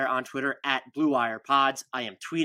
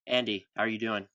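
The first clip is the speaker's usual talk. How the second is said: normal talking voice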